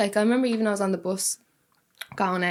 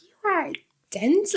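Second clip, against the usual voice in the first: slow, high, exasperated verdict; retold annoyance; 7 semitones higher, much slower than usual. Girl privately judging the man on the bus, annoyed. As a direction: draw it out in silent disbelief. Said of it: "I was getting really annoyed".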